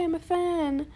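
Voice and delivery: ordinary talk, nothing marked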